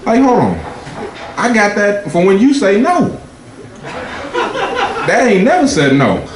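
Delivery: high, indignant mock protest; incredulous defense. To his jealous girlfriend, high incredulous protest. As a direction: defend yourself with disbelief.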